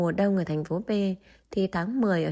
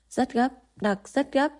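put-on higher-pitched, serious child voice; earnest little boy; 6 semitones higher than usual. A narrator's reading. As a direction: insist earnestly like a small boy. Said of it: "Tô Tiểu Tông nghiêm túc trả lời". (giọng bé trai cao, nghiêm túc)

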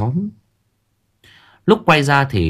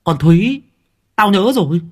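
higher, loud eureka; sudden realization; 10 semitones higher, 5 dB louder than usual. Acted. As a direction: burst out remembering. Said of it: "bất ngờ nó à một cái". (bất ngờ nhận ra, reo lên)

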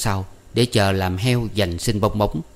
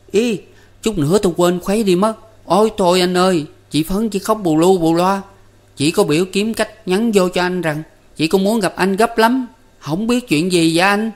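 put-on higher, quicker excited kid; boy Năm; 10 semitones higher, 4 dB louder than usual. A narrator's reading. Năm passing Phấn's message to Tư Cầu, higher excited boy. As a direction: blurt it out, just remembered.

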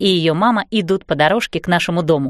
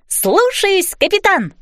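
higher, eager sailor reply; eager cabin boy; 10 semitones higher, slower than usual. A narrator's reading. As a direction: snap to it like a young deckhand. Girl plays ship's boy.

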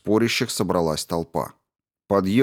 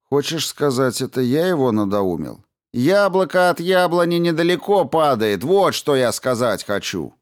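angry shouting; 7 semitones higher than usual. A narrator's reading. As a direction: bellow the insult back. Brin shouting in quarrel.

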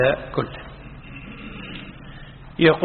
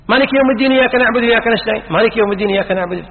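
fast, raised recitation; rushed recitation; 4 semitones higher, much faster than usual. Shown as a demonstration. Teacher acting out hurried recitation, fast. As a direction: rattle the verses off. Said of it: "demo of rushed recitation".

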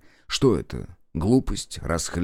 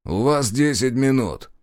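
slow, higher-voiced order; deliberate, raised-pitch warning; stern warning; 5 semitones higher, 3 dB louder than usual. A narrator's reading. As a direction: lay down the time limit firmly. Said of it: "предупредил".

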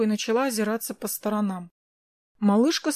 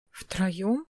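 softer, rising question; hesitant, bewildered echo; confused; 3 semitones lower, 5 dB quieter than usual. A narrator's reading. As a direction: ask back, caught off guard. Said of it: "растерянно переспросила я".

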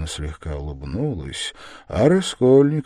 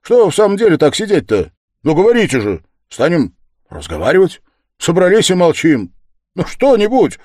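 loud, high, insistent demands; agitated raised-voice urging; crying out; 12 semitones higher, 11 dB louder than usual. A narrator's reading. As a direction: goad everyone in exasperated bursts. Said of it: "вскрикнул он вдруг совсем неожиданно".

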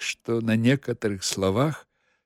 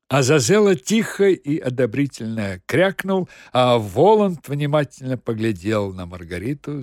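ordinary delivery, nothing marked

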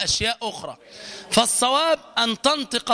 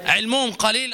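ordinary delivery

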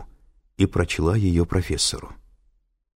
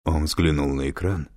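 ordinary delivery

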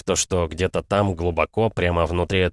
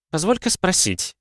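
put-on suspicious girl voice; 11 semitones higher than usual. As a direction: drag it out like a doubting young girl. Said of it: "с подозрением протянула".

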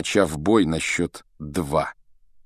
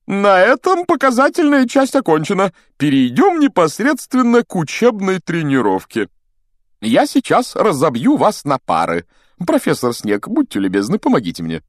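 hasty announcing; 12 semitones higher, 5 dB louder than usual. A narrator's reading. Pompous teacher moving pupils on to practice, hasty announcing. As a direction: announce grandly high, then ease down. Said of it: "Локонс поспешил добавить".

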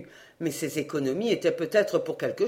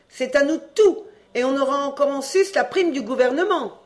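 put-on gleeful shouting boy; 8 semitones higher, 4 dB louder than usual. A narrator's reading. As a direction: crow over the loot like a little pirate.

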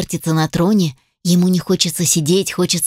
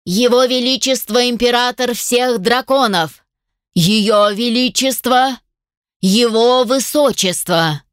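slow, raised herald announcing titles; town crier; 5 semitones higher, slower than usual. A narrator's reading. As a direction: call out the royal titles like a herald. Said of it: "и глашатай объявил".

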